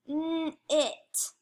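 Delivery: "N-it" split as a held N, pause, then IT; segmented word. Shown as a demonstration. Teacher helping pupils hear the middle sound, segmented. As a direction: sound out "N-it" in two separate pieces. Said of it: "let's segment that".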